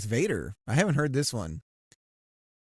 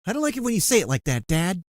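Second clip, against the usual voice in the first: higher, louder, fast whining complaint; whiny son; 6 semitones higher, 6 dB louder, faster than usual. Acted. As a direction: protest like a sulky kid to dad. Whiny Luke answering Vader.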